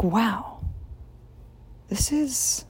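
whispered inner thought. Retold or imitated. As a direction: whisper it to yourself.

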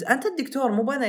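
angry retort. Retold or imitated. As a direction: hammer it home again, heated. Repeating the retort to the doctor. (ترديد الرد بانفعال)

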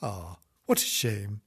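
usual speaking voice

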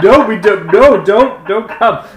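high, loud pleading no; loud panicked refusal to the caller; desperate refusal. Retold or imitated. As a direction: beg them not to come, loud and high. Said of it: "trying so hard to be like".

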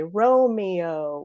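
"Romeo" elongated into ro-MEE-oh; lingering, unelided "Romeo"; all three syllables. Shown as a demonstration. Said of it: "with all three syllables".

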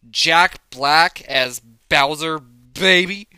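loud, slow, drawn-out hype call; excited hype. Real, not acted. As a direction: sell it like a big announcement. Hyping his favourite casting.

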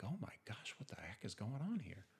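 breathless whisper, almost no voice; whispered panic. Retold or imitated. Retelling his thoughts as the car flipped, in a stunned whisper. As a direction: hush it like a thought you can't say aloud.

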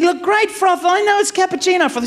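high-pitched exasperated retort. Retold or imitated. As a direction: humour him through mounting impatience. Exasperated reply to distracted John.

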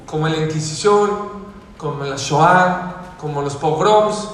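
high, loud sermon voice, pressing the congregation hard; loud, high preacher urging his listeners; fiery preaching. Acted. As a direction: preach it with full force. Preaches at full force.

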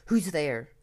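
loud, startled cry; mild shriek. A narrator's reading. Narrator waking to voices in the dark, startled mild shriek.

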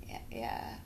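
whispered sheepish yeah; embarrassed whisper. Retold or imitated. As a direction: mumble it under your breath, ashamed. Embarrassed reply to nurse.

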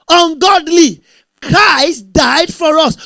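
a full-force preacher's cry; preaching shout. Real, not acted. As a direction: thunder it to the congregation.

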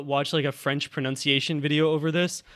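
normal talking voice

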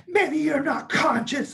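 high mock-snotty comeback; snippy girl impression. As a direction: sniff it out like an offended girl. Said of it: "She's like".

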